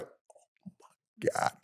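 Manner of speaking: hushed, voiceless whisper; stunned aside under the breath; whispered disbelief. Retold or imitated. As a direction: whisper it to the person right beside you. Aside to Michael right next to him.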